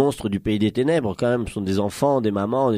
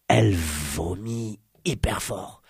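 very slow, lowered storyteller voice; suspense appuyé; 5 semitones lower, 5 dB quieter, much slower than usual. A narrator's reading. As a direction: draw each word out before the big moment. Suspense avant le gros vomi. (narration très lente, ton grave)

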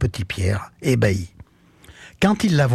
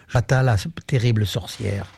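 plain reading voice

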